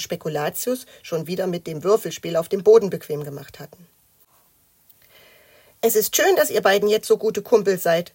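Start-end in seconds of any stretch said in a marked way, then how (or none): 5.8-8.1 a touch of sulky reproach; slightly miffed. A narrator's reading. Matz reproaches the two new pals, slightly miffed. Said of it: "sagte Matz ein wenig eingeschnappt".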